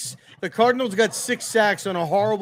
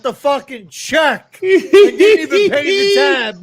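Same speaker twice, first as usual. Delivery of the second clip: loud, slow, high outraged yell; shouting; 8 semitones higher, 7 dB louder, slower than usual. Real, not acted. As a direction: yell it out in disbelief. Roasting friend over the jersey.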